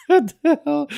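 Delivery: a startled laugh through the words; laughing disbelief. Real, not acted. Streamer laughing at a janky old game model. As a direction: laugh it out, half shocked.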